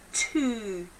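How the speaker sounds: "Two" with a long rounded oo; rounded demo two. Shown as a demonstration. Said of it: "Nice round lips".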